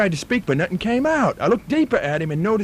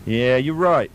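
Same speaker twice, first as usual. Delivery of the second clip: broad put-on Australian bloke spinning a yarn; Australian accent. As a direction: play an outback Australian storyteller.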